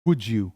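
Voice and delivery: would you blended as "wujoo"; linked would you. Shown as a demonstration. Teacher models linked would you for repetition. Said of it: "मेरे पीछे repeat करिए".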